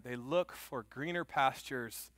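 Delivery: slow, weighty words; slow emphasis. Acted. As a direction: lean on each word, preacher style. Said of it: "preacher slows to drive the point home".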